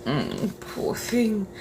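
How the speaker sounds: normal talking voice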